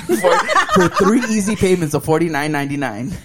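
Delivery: laughing mock sales pitch; infomercial. Mock selling piss as deal.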